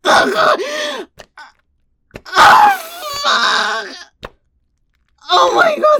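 high, gasped outbursts; climactic cries. Acted. Wife at her peak on the phone, crying out. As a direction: cry out high in broken bursts.

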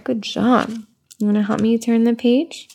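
ordinary talk, nothing marked

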